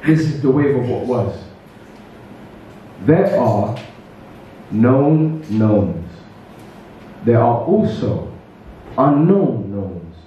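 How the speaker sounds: deliberate spoken word with long pauses; poetry recital. Acted. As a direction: set each phrase apart for the audience. Recites own poem to audience.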